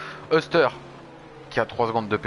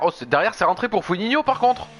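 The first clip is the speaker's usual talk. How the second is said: excited; 10 semitones higher, 5 dB louder than usual. Real, not acted. Rival pits, dramatic moment.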